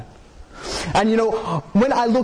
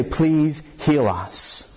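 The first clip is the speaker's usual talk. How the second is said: pleading; 6 semitones lower, much slower than usual. Acted. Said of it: "And they say".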